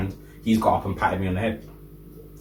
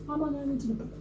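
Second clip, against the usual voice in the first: much higher, quiet indignant squeak; indignant disbelief; 10 dB quieter, faster than usual. Retold or imitated. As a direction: ask it in stunned disbelief, high up. Retold indignant protest, very high.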